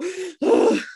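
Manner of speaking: high, drawn-out sigh; stressed-out sigh. Real, not acted. Overwhelmed by the couple on the show, stressed sigh. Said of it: "Annika, I'm stressed out".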